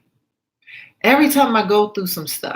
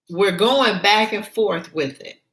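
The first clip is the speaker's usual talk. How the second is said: normal talking voice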